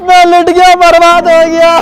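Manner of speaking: theatrical weeping lament; mock crying. Old man lamenting his ruin, crying. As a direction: wail it out as if sobbing. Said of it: "कैसा रो रहे हो?".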